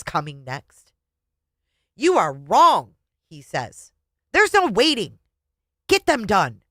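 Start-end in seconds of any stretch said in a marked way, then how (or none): 2.0-3.0 slow, loud, angry accusation. A narrator's reading. Husband waving finger in wife's face, angry. 4.3-6.6 high, loud, angry command. A narrator's reading. Husband demanding the cards from his wife, angry.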